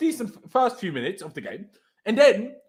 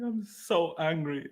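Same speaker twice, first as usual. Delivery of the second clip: slow, drawn-out mock angry; slow mock anger; 4 semitones lower, 7 dB quieter, much slower than usual. Shown as a demonstration. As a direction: stretch each word in mock fury.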